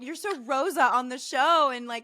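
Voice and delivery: gushing reassurance. Retold or imitated. Re-enacting earnest reassurance to bothered friend.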